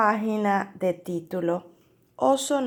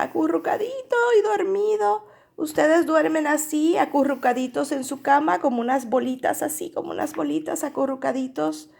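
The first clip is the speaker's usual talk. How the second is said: put-on higher, fast cooing to children; 6 semitones higher, faster than usual. Shown as a demonstration. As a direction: coo over the cute little bear. Teacher coos over curled-up bear.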